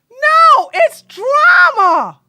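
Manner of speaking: slow, high shouted reveal; dramatic shout. Pretend. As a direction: belt it out slowly. Dramatic declaration.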